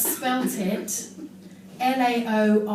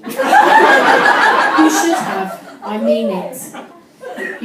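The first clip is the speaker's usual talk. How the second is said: much louder, very slow, words stretched by laughter; laughing hard; 4 semitones higher, 12 dB louder, much slower than usual. Real, not acted. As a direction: squeeze it out between laughs. Laughing hard mid poem.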